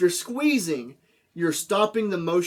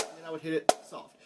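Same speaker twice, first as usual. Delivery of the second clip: hushed on the soft hit; 8 dB quieter than usual. Shown as a demonstration. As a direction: drop to a soft, quiet voice. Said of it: "you just kinda go".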